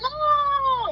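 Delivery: very high, drawn-out squeal; mock scream. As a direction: squeal like someone trying to escape. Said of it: "he's just screaming".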